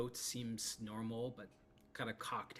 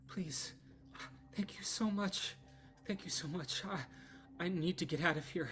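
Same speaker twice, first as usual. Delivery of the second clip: higher, breathless plea for rescue; pleading injured young woman; 5 semitones higher than usual. Acted. Lydia begs the party to take her away, pleading. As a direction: beg them to get her out of here.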